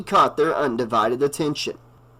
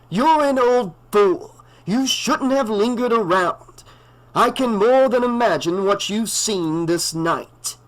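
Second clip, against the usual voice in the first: put-on grim old doctor; 8 semitones higher than usual. A narrator's reading. Loomis voice.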